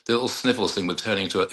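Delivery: usual speaking voice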